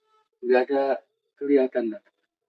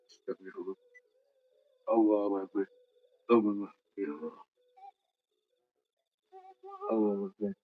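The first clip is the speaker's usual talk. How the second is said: much softer murmured prayers, near whisper; whispered frightened prayers; 11 dB quieter than usual. Real, not acted. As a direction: pray under your breath, scared alone in the dark. Alone at night, frightened prayers.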